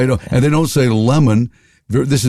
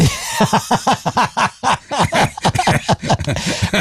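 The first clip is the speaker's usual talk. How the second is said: laugh; 10 semitones higher than usual. Real, not acted.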